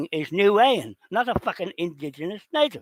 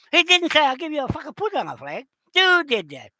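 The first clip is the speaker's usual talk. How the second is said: loud, higher, fast accusing; furious higher-pitched accusation; angry accusation; 10 semitones higher, 4 dB louder than usual. Real, not acted. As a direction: throw the blame at them. Accusing the listeners.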